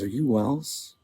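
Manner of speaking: softly imitated stunned old man; hushed, tentative question. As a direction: ask it softly, as if in disbelief. Old man stunned, blood draining.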